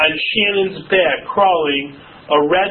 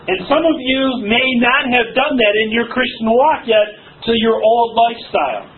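ordinary delivery

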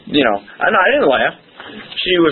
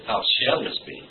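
hushed; 4 semitones lower, 10 dB quieter than usual. Retold or imitated.

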